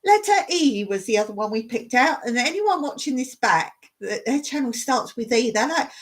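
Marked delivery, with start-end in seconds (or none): none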